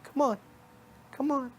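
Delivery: high, squeaky-pitched bunny voice; little bunny rabbit. Acted. Bunny rabbit beckoning the listener to follow, high little voice. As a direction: pitch it way up as a tiny coaxing rabbit. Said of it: "And the bunny rabbit saying".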